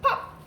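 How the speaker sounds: high sharp "pop!"; cheerful high pop noise; popping sound effect. Acted. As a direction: make the button pop with a bright squeak. Button pops off.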